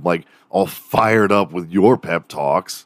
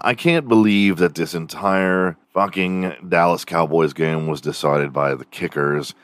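ordinary talk

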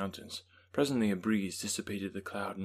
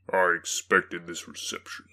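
the demon; 2 semitones lower, 5 dB louder than usual. A narrator's reading. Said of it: "said the demon".

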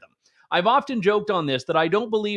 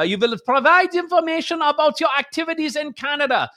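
a hammed-up menacing German accent, higher and commanding; 9 semitones higher, 3 dB louder than usual. Shown as a demonstration. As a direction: bark it like a mock German officer in a comic German accent. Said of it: "If you say it with a menacing German accent".

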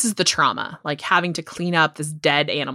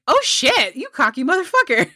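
shocked outburst; 8 semitones higher than usual. Retold or imitated. Retelling own shocked reaction.

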